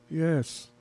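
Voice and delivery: usual speaking voice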